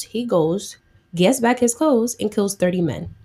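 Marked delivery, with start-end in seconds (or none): none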